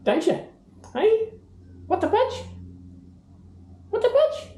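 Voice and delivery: very high, bright prompting; sing-song up at the bird; high coaxing pet voice. Acted. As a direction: owner coaxing the parrot to repeat his phrase, very high. Coaxing parrot again.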